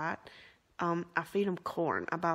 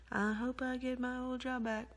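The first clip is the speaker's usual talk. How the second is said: imitating earlier self; 5 semitones higher than usual. Quotes own earlier wishful remark.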